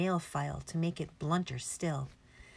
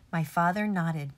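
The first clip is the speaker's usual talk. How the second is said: ordinary delivery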